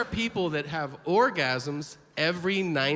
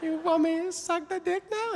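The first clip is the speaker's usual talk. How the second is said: very high, sing-song exaggerated Chinese accent; high-pitched mock Chinese accent with slow pauses; 13 semitones higher than usual. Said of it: "lady just happens to say".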